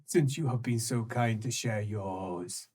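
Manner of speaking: low sly taunt; sinister creature voice. Acted. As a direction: purr it out slyly. Creature repeats line.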